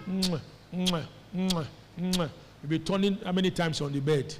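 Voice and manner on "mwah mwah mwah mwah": sleep hissing. Retold or imitated. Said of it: "hissing while you are sleeping".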